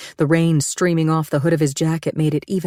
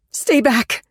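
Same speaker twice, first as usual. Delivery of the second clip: high raised stay back; frantic warning; 8 semitones higher than usual. Acted. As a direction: fend him off with the umbrella. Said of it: "looking frantic".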